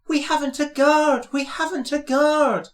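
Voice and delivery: alarmed call. A narrator's reading. Annie calls out to Thomas.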